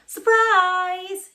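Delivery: high, loud squeaky mouse cry; Town Mouse cry. Acted. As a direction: burst out with a big surprise. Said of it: "he cried".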